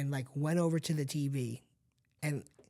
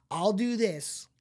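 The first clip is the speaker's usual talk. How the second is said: high, slow mock-boastful vow; slowly hammed-up bravado; cocky teen promise; 6 semitones higher, 6 dB louder, slower than usual. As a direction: drag out a cocky kid's promise.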